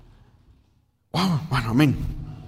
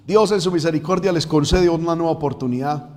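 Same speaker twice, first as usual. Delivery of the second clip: normal talking voice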